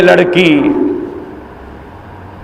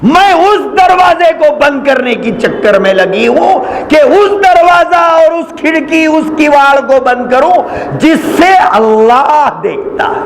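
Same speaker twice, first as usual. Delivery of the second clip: fervent sermon shout; preaching at full force; 11 semitones higher than usual. Acted. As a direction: cry it out from the pulpit.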